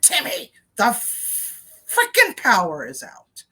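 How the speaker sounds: high-pitched mock scream, held back in volume; exasperated imitated yell; retold scream. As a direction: scream the name, stall on the f, then push through. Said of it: "So finally I just screamed".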